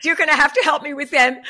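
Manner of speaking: laughing, high and loud. Real, not acted. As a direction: laugh through the words. Laughing at the praise.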